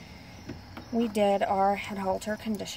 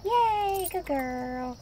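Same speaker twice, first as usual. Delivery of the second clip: cheer; 9 semitones higher, much slower than usual. Real, not acted. Praising dog.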